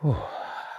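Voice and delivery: soft exhaled sigh. A narrator's reading. Relief after the angry visitor leaves.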